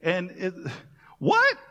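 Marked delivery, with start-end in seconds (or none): 1.2-1.6 very high, loud disbelieving what; mock stunned yelp; incredulous. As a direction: squeak out your disbelief. Reacting to Jesus appearing.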